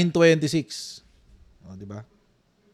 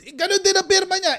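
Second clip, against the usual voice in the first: incredulous; 12 semitones higher than usual. Real, not acted. Disbelief at same signature.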